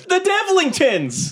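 very high, drawn-out echo of a yell; high-pitched in-character call; acted rallying cry. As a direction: take up the cry, long and high. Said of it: "So he just says".

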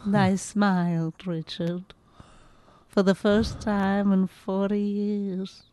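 slow, soft, fading to a whisper; a frail old woman savouring a rare smile; old lady voice. Acted. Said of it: "Sounds like Missus Daisy or something".

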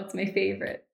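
ordinary talk

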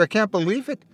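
high, rising teary wonder on "can't"; tearful disbelief. A narrator's reading. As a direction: lift "can't" up in tearful awe. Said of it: "through his tears".

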